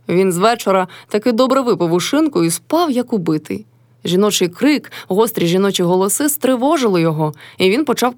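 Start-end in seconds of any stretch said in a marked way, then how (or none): none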